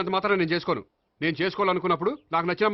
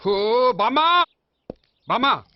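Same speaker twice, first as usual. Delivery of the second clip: loud, raised call for grandmother; loud yelling; 5 semitones higher, 6 dB louder, much slower than usual. Acted. Upset grandson calling his grandmother, yelling. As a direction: holler through the house for her. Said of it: "ఎందుకురా అంత గట్టిగా అరుస్తావు?".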